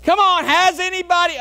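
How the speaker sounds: loud, high urging call; pushing the room to answer. Real, not acted. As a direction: rouse the congregation. Calling on the congregation.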